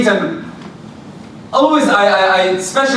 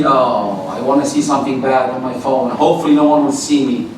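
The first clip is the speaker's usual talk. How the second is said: much lower, fast mock inner voice; imitating a sinner; 10 semitones lower than usual. Preacher voices a believer hiding sins on his phone, low mock tone. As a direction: drop low and play the sneaky sinner. Voicing a sinner's secret thought.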